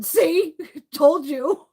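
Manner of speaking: high, loud laughing told-you-so. Retold or imitated. Kid after throwing up, high loud laughing told-you-so. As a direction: crow it through laughter.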